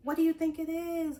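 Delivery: usual speaking voice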